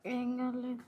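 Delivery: softly, holding back tears; quiet tearful denial. Acted. Wife to husband asking why she cries, quiet tearful denial. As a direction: hide the crying, barely voiced. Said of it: "ఎందుకు ఎడుతున్నావు?".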